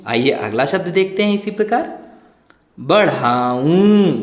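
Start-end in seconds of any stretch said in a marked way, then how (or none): 2.8-4.1 बढ़ाऊं said slowly with the final ऊं nasalised through the nose; nasal ऊ demo. Shown as a demonstration. Teacher slowly models बढ़ाऊं for pupils. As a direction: stretch out बढ़ाऊं, nasal ending. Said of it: "ऊ पे चंद्रबिंदु लगी है".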